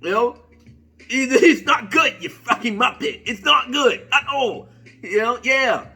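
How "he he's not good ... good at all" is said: laughing mock British accent, higher pitched; amused, raised-voice British impression. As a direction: scold in a hammed-up British accent. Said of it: "he's saying in his British accent".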